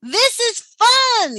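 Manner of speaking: high, loud whooping cheer; thrilled shout of fun; excited yell. A narrator's reading. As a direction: whoop it with joy, slowly. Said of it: "yelled Maynard".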